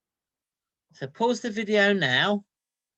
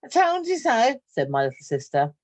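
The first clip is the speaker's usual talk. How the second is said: lively read-aloud storytelling voice; story narration; 5 dB louder than usual. A narrator's reading. Teacher reading a story to her class, lively. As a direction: tell it like a picture book to children.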